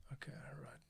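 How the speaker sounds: unvoiced whisper, a note to himself. Real, not acted. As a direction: whisper it aside while working. Quiet aside while editing.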